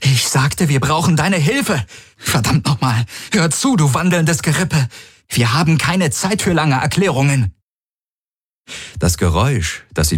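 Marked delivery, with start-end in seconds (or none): 0.0-7.5 higher, insistent and exasperated; impatient anger. A narrator's reading. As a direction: snap at a walking skeleton. Repeats demand, impatient and angry.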